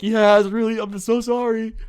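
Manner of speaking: very high, squeaked insincere apology; badly faked apology. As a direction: plead sorry unconvincingly in a loud, squeaky pitch. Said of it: "it's just coming out really bad".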